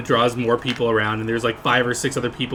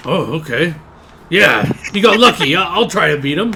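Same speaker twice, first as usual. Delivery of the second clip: loud, higher orc voice; gruff orc; 5 semitones higher, 8 dB louder than usual. Acted. Orc agreeing to the player's rematch, loud and higher. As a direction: take up the challenge with gusto.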